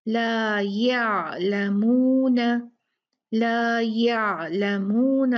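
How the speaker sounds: ला या लमून with the ain drawn out slowly; ain given time. Shown as a demonstration. Teacher shows students how to voice the ain. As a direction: hold the ain in लमून, no rush. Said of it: "आइन को थोड़ा time दिया करें".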